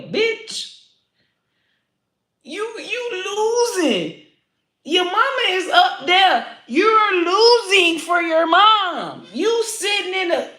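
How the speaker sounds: high, slow, crying scold; tearful scolding. Real, not acted. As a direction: scold them with tears in your voice.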